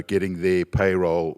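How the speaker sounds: ordinary delivery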